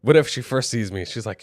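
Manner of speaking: ordinary delivery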